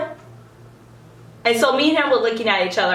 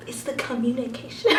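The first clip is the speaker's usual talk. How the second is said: soft, lightly imitated earnest line; mocked quote; 8 dB quieter than usual. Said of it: "making fun of the fact that Jackson was like".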